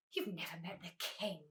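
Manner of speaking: low, quiet accusation; understated anger. A narrator's reading. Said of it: "accused the bee angrily".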